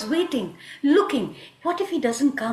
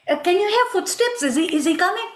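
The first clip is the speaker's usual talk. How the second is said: raised pitch, listening out with urgent hope; anxious anticipation; 6 semitones higher, 3 dB louder, faster than usual. Acted. Radha listens for Krishna coming after her, anxiously hopeful. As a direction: strain eagerly for his footsteps.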